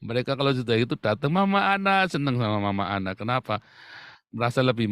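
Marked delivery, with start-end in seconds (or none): 1.3-2.1 put-on kids calling out. Children greeting the wife.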